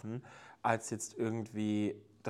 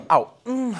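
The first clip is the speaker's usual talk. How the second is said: sudden loud ow of pain; pained yelp; 16 dB louder than usual. Real, not acted. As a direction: yelp as you stub your toe. Ich hab meinen Fuß angestoßen.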